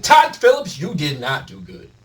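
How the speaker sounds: loud call-out to Todd Phillips. Real, not acted. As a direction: shout his name at him. Calling out the director.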